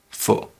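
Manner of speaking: fu said short, soft and light; barely touch fu, with no pitch movement; neutral tone. Shown as a demonstration. Teacher isolating the neutral syllable, fu quick and soft. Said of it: "second syllable is with a neutral tone".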